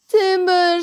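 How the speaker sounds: high, loud, drawn-out timber call; shouted. A narrator's reading. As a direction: shout a long warning as it topples.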